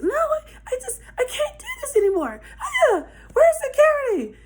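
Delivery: very high imitation of a girl melting down; hammed-up hysterical squeal; mock hysterics. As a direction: wail it way up high. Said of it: "she going crazy in the front seat".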